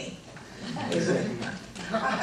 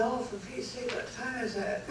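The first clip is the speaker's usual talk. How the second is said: sleepy grumble; 5 semitones higher, 8 dB quieter than usual. Retold or imitated. Husband woken at night.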